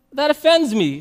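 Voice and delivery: offended. Acted. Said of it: "says Canadian".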